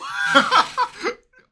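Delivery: high, loud laughing burst; real shrieking laugh. Real, not acted. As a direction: crack up at the line. Laughing at rap line.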